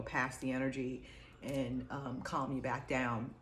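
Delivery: usual speaking voice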